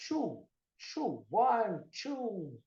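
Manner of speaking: "two" said as "chew"; common mispronunciation. Shown as a demonstration. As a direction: soften the t of "two" into a ch. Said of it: "muita gente fala".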